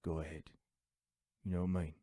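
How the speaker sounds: low, cool tough-guy voice; Jason Statham. Retold or imitated. Action hero answering a goon's threats, low and cool. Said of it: "he's just like".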